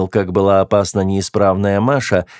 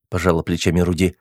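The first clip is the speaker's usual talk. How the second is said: ordinary delivery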